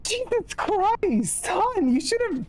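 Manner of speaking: high, slow, shocked exclamation. Real, not acted. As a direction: gasp it out in disbelief. Shocked reaction to the joke.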